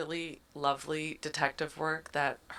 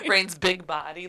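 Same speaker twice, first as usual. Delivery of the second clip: usual speaking voice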